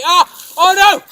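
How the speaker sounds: high, loud shriek of shock; shocked scream. Real, not acted. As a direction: caught off guard by a soaking. Surprise second bucket poured.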